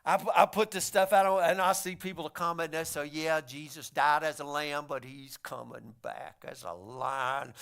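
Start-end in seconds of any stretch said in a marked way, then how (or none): 3.0-7.5 low, hushed and ominous mock voice; menacing commenter. Commenters say he's coming back as a lion, menacing. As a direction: drop low and quiet, slowing into a threat.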